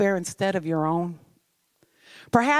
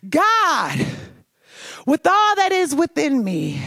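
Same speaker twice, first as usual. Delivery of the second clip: raised, pleading, slowed prayer with breaking high cries; anguished cry to God; 4 semitones higher than usual. Retold or imitated. Retelling her night prayer to God, anguished and pleading. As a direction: cry out to God in exhausted despair, each "tired" falling. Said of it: "I cried out".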